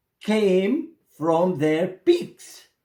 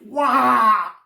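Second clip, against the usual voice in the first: high piercing shriek; a sudden falling bird's cry; sharp high bird shriek; 4 semitones higher, 4 dB louder than usual. A narrator's reading. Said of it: "his bird shrieked".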